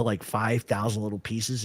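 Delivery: ordinary talk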